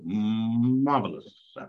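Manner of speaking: "Marvelous" stretched long and slow; drawn-out relish. Acted. Preacher savours Judith's beauty from the reading, drawn-out "Marvelous". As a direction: hum into "Marvelous" and savour it, hammed-up. Savours the word he just read.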